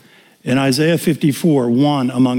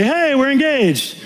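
mock excited announcement; 12 semitones higher, slower than usual. Announcing engagement, imagined.

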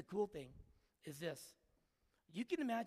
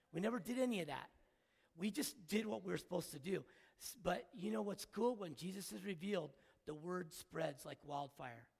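normal talking voice

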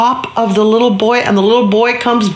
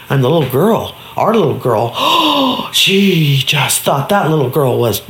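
ordinary delivery, nothing marked

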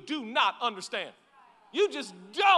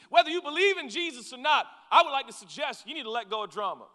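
ordinary talk

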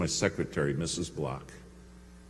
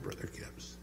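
soft whispered name; a pleading whisper to her boss; whispered plea; 19 dB quieter than usual. Retold or imitated. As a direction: breathe the name gently. Secretary pleads softly.

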